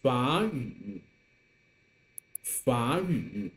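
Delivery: 法 rising like a second tone before 语; teacher's model of 法 with rising tone; third tone changed to second. Shown as a demonstration. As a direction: lift 法, then drop into third-tone 语. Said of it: "some more examples that you can join".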